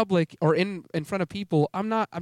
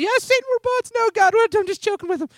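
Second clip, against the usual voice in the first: put-on high, loud little-kid squeal; squeaky, excited child voice; five-year-old child; 6 dB louder than usual. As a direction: imitate a small kid gleefully confiding in God. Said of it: "like, five years old".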